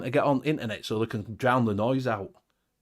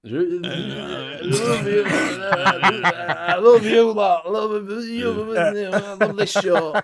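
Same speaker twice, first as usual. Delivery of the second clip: put-on drunken Graham; 6 semitones higher, much slower than usual. Co-host imitating a drunk regular on request, slow slurred wailing. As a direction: drag the words out like a sloppy, affectionate drunk. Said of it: "How does he talk when he's pissed".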